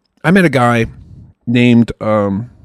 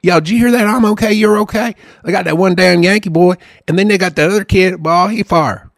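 hammed-up Southern country twang; higher-pitched backwoods Southern accent; 4 semitones higher than usual. Shown as a demonstration. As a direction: play a country boy gossiping in thick Southern dialect. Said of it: "I could talk country all day long".

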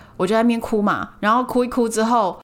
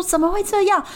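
imitating a much higher, alarmed mother; worried mom; 11 semitones higher than usual. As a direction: squeak it out like a startled mom. Mom voiced in story.

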